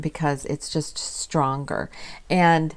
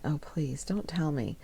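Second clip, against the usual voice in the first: soft pleading whisper, retold; upset whisper; 9 dB quieter than usual. Dreading the product is gone, whispered plea. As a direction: breathe it out like dreading bad news. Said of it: "And I was just upset".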